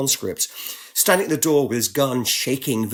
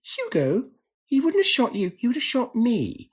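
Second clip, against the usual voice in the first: lightly voiced Hatuna; 10 semitones higher than usual. Interpreter Hatuna's quote.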